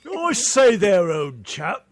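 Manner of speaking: put-on slow, posh British I say there, old chap; British accent. Radio friends joking, a mock British greeting. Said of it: "copy Randy".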